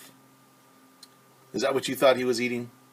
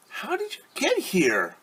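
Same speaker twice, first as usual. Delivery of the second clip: much higher, wide-eyed caterpillar; 12 semitones higher than usual. Acted. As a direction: ask it in a small high caterpillar voice. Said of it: "said Caterpillar".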